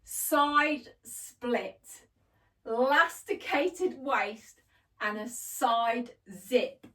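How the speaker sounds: each feature stated slowly and distinctly; deliberate, emphatic recap; careful clear listing. Shown as a demonstration. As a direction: lay out the features one by one. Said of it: "So let's do it again".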